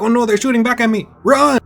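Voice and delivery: panicked yell. Acted. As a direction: shout it while running for your life. She flees under fire.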